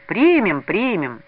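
lively crowd welcome, falling off; noisy, cheerful chorus. A narrator's reading. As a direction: cheerfully call out acceptance. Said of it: "Шумно и весело донеслось до крыльца".

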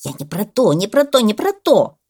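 loud, rising exasperated repeats; shouting parrot. A narrator's reading. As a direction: build to a loud protest. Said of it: "закричал попугай". (крик попугая)

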